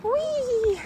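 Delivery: high gleeful whee; excited squeal. Real, not acted. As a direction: squeal like on a ride. Stroller going downhill.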